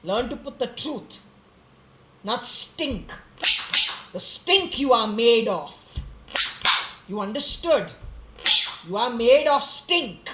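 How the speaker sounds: loud, slow furious yelling; furious shout. Real, not acted. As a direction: shout him down in disgust. Furious rant at the man.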